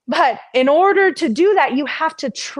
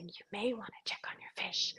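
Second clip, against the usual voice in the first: soft breathy whisper; 3 semitones lower, 22 dB quieter than usual. Retold or imitated. Inner voice gently nudging her to check the fish, whisper. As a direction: barely whisper the nudge.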